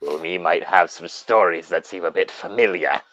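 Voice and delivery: horror host narration. Acted. Continuing horror host intro.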